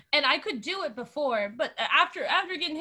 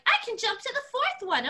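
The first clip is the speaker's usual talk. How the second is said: put-on boastful boy; 7 semitones higher than usual. Boy boasting on monkey bars.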